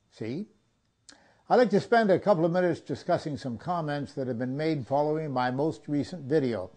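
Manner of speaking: ordinary talk, nothing marked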